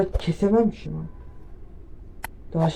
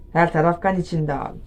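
usual speaking voice

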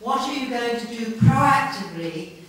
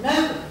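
higher and louder, hammering the word Nobody; emphatic answer; 7 semitones higher, 5 dB louder, much faster than usual. Real, not acted. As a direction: land Nobody hard as the damning answer. Rhetorical question answered emphatically.

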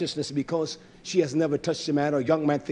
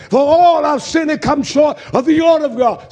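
loud, high, fervent preaching; preaching at full force; 8 semitones higher, 10 dB louder than usual. Real, not acted. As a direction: thunder it from the pulpit.

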